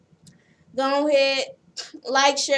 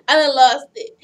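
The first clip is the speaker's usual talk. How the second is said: normal talking voice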